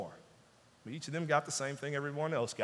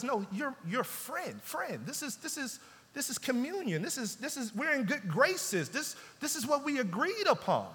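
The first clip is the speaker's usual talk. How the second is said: higher and hushed, pleading softly; tender reassurance; 6 semitones higher, 4 dB quieter than usual. Acted. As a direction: coax him back into friendship. Master reassures worker, tender.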